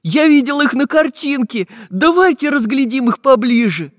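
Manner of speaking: put-on high, loud, eager child voice; overjoyed. A narrator's reading. Prutik wants a closer look at walruses, high and overjoyed. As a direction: urge everyone on with delight. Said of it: "обрадовался Прутик".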